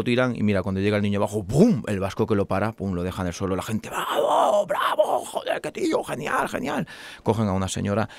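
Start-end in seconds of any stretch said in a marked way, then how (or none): 3.9-6.9 high, breathy cheers of bravo; cheering crowd. Acted. Onlookers cheering the Basque man's catch, high and breathless.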